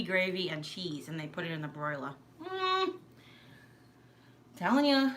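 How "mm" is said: high, drawn-out yummy "mmm"; savoring hum. Real, not acted. Recalling disco fries with gravy, savoring high hum. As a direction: hum with relish at the food.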